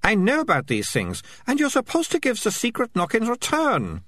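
lightly voiced know-it-all girl; bossy girl voice. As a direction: play the bossy young girl. Melicia voiced high.

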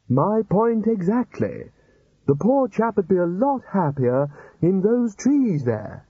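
high, slow wheedling wizard voice; wheedling old wizard. Acted. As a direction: plead the point sweetly.

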